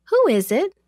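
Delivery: put-on high-pitched questioning servant girl; high, curious girl at the door; servant girl's query. A narrator's reading. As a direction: ask in a high voice, calling to whoever knocks.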